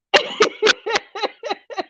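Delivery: a breathy high giggle; high laughter. Real, not acted. Host giggles at the viewer again, high laughter.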